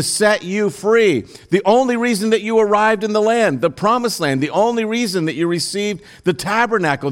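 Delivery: high, loud, driving preacher voice; preaching at full force. Acted. Preacher confronting his congregation with their history, full force. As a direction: hammer each "remember" home to the crowd.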